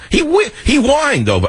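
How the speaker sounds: high-pitched amazed report; excited disbelief. Real, not acted. Host tells listeners his dog whined, high and amazed. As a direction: tell it with the pitch shot up.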